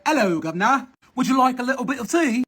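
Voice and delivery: mock British "guv'nor" voice; hammed-up British phone greeting; playing-around British accent. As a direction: put on a playful British accent. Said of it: "accents they doing is the shit".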